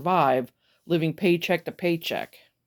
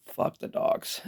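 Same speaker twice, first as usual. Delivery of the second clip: breathless whispered swear; whispered curse; 7 dB quieter, slower than usual. Real, not acted. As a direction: hiss the curse with no voice. Repeated curse, now whispered.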